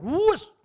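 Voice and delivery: loud high whoosh of a speeding motorbike; preacher mimicking a motorbike racing the red light. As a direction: make the bike zoom past.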